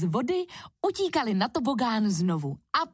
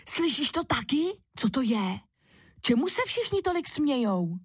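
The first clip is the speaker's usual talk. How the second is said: put-on curious child asking; 6 semitones higher than usual. A narrator's reading. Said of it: "ptal se Kuba Ferdy".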